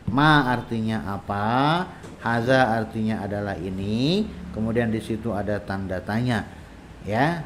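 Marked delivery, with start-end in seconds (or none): none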